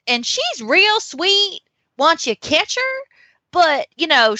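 lilting, sweet-talking Southern seller; Southern accent. Acted. Seller assures buyers the pony is sweet, Southern accent. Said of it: "it has to be Southern".

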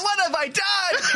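high, drawn-out melodramatic cry; slow, over-the-top shout of regret; mock horror. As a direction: wail it in hammed-up horror. Hammy movie quote, joking skit.

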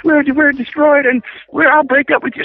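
imitating girlfriend's high, frantic outburst; mock her freaking out, pitch way up; flipped out. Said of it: "She flipped out".

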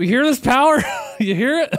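high, loud, laughing brag about the engine; excited salesman. Retold or imitated. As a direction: show off the noisy engine, giddy. Salesman boasting over engine noise.

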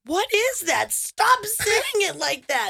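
high, drawn-out protest; mock indignation. Cohost objecting to everyone's pronunciation, slow indignant wail. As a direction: whine it in exaggerated disbelief.

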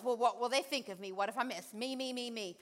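mock self-pitying mutter; softly ape a self-absorbed worrier; selfish whining. Mocking selfish inner voice.

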